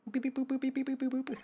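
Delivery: high monotone beeps and boops; robotic beeping speech. Acted. Singer talking in an unknown language, high monotone beeping. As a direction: beep and boop steadily on one high note.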